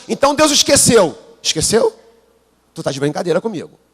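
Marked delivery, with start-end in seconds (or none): 0.1-2.0 higher, disbelieving echo; mock incredulous. Preacher mocking a colleague's claim, incredulous. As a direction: scoff at the idea that God forgot.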